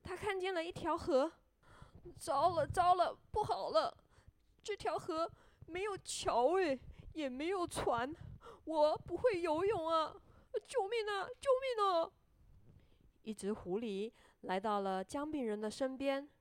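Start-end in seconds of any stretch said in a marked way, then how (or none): 2.2-12.1 high-pitched storybook alarm; acted panic. A narrator's reading. Gingerbread man stopped by a river, high acted panic. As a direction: wail it high, crying for help.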